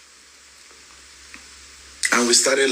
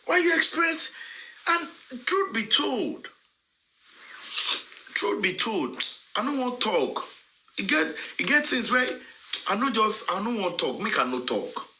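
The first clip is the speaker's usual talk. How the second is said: very high-pitched, incredulous exasperation; exasperated scolding; 13 semitones higher, 5 dB quieter than usual. Real, not acted. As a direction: scold in a high voice, holding back words. Scolding critics, holding back words.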